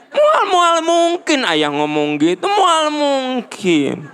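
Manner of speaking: hammed-up husband protesting his innocence. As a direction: stretch out moal mungkin as an over-the-top denial. Husband protesting in acted quarrel.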